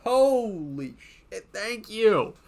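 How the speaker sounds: loud, high, thrilled thanks for a subscriber; excited shout. Real, not acted. Streamer thanking a six-month subscriber, excitedly loud. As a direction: burst out with delight at the alert.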